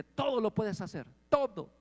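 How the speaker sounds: mock the pushy believer in everything; imitating an enthusiast.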